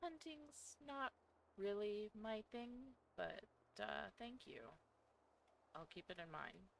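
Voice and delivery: soft, hesitant alter voice; quiet shy persona. Acted. Coco persona continues.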